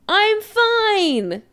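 high, loud insistence; shrill. A narrator's reading. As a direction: insist shrilly, far too upset to convince. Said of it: "Chris says shrilly".

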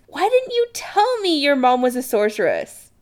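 high-pitched indignant demand; exclaiming. Acted. Adam to Chris who kept a secret, indignant exclamation. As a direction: exclaim it in agitated disbelief. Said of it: "Adam exclaims".

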